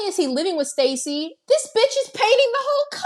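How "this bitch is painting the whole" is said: outraged exclamation. Real, not acted. Scandalized host recapping the movie to her co-host, outraged squeal. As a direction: pitch shoots way up in disbelief.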